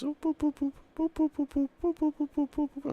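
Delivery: playful high whoops for each mouse jerk; high whoop sound effect. Acted. Showing viewers the mouse speed, he chirps high whoops.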